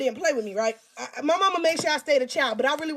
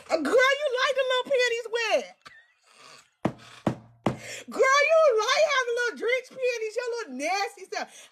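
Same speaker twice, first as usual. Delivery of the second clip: mock scolding; 7 semitones higher than usual. Mocking her younger self.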